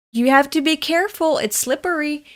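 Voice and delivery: put-on high, quick caution to a child; urgent warning. Adult to a boy racing on ice, urgent retold warning.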